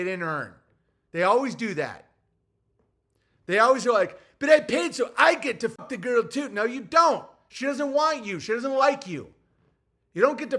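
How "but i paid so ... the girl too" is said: mock whiny entitled male; whiny entitled guy. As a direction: whine it like an entitled loser. Mocking low status males.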